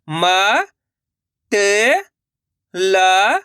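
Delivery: म, त, ल each separate and held long; letter-by-letter demo. Shown as a demonstration. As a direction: sound out म, त, ल with pauses. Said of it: "एक अक्षर का उच्चारण सही से". (म, त, ल अलग-अलग खींचकर)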